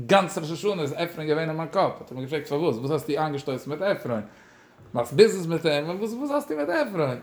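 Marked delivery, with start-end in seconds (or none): 6.0-7.2 high, incredulous what; surprised question. Retold or imitated. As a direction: ask it in sudden disbelief, voice jumping up. Rebbe asks about Ephraim.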